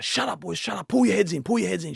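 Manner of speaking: yelling to quiet down. Retold or imitated. Captain yelling at his teammates to shut up, loud. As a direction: bark it up high like a captain calming the lads. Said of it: "And then he yells that out".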